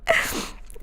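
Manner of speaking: whispered giggles mixed with tears; half-laughing half-crying whisper; laughing through tears. Real, not acted. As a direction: laugh and cry at once, real. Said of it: "like, laughing, but also crying a little bit".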